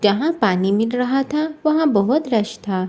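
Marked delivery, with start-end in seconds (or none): none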